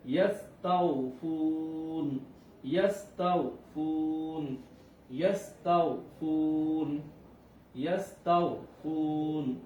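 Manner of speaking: ইয়াস্তাউফুন with তাও read short and ফু stretched; don't pull তাও, lengthen ফু; quick lin, long madd. Shown as a demonstration. Teacher contrasting lin and madd letters for students, slow. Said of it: "তাও আমরা দ্রুত পড়ছি".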